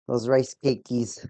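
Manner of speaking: "rice cakies" with a cutesy -ies ending; meme pronunciation. Real, not acted. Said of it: "Why do I wanna say it like that?".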